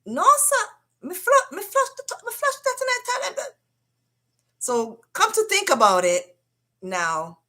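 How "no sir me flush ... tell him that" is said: much higher, put-on broken English accent; brother's accent. Brother denying on the phone, put-on accented higher voice. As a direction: voice him in thick accented pidgin, higher. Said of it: "And he's like".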